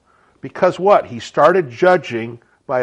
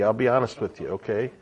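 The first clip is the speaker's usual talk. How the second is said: ordinary talk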